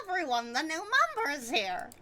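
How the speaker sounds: high character announcement; announcer voice. Acted. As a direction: announce it brightly. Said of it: "Let me try that again".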